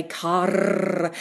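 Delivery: CAR with a long trilled R at the end; rolled R. Shown as a demonstration. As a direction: roll out the R in "car". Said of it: "rolling R as being a primary feature".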